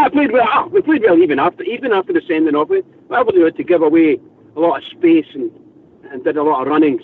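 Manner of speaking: usual speaking voice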